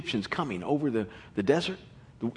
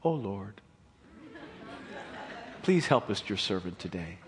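mock meek prayer; 7 semitones lower, 7 dB quieter than usual. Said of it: "it probably didn't sound like this".

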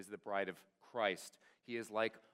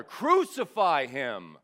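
louder, slower drawn-out roar; roaring mob; 2 semitones higher, 12 dB louder, slower than usual. A narrator's reading. Preacher voicing the mob roaring louder at Pilate, slow roar. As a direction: stretch it out and roar it even harder. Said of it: "they roared even louder".

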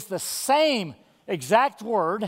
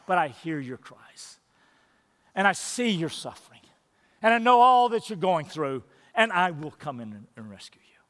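tender reassurance; 4 semitones lower than usual. Acted. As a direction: comfort them, let it fall away. God's tender promise voiced.

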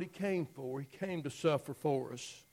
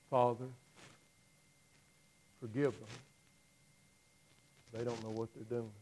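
tender plea; 3 semitones lower, 5 dB quieter, faster than usual. Retold or imitated. Jesus voiced forgiving.

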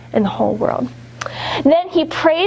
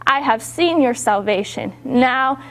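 ordinary delivery

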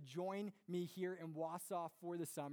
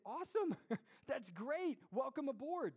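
cheery welcome; 7 semitones higher than usual. Retold or imitated. Imagined cheery response.

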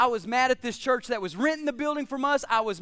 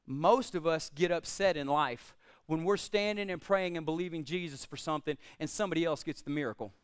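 ordinary delivery

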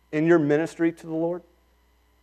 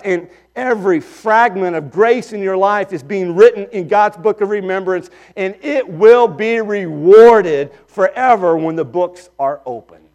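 preaching at full force; 3 semitones higher, 5 dB louder than usual. Acted. Preacher assuring the congregation that God is just, full-force preaching. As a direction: press the promise home from the pulpit.

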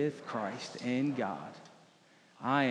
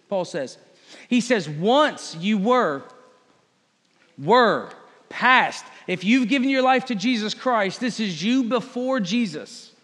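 loud, high, driving sermon voice, pressing each point; fiery preaching; 7 semitones higher, 7 dB louder, faster than usual. Acted. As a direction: preach it at full force to the congregation. Preacher exhorts congregation at length.

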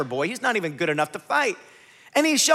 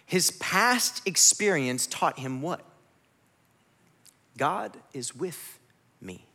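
slow soft emphasis; 7 semitones lower, 6 dB quieter, slower than usual. Acted. Slow soft preaching climax.

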